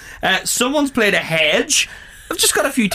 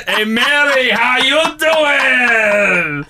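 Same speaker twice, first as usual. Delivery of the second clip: drawn-out, slow Joey Tribbiani line; Joey from Friends; 6 semitones higher, much slower than usual. Retold or imitated. Host retelling a nativity text, doing a slow drawn-out Joey. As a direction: stretch it out like Joey.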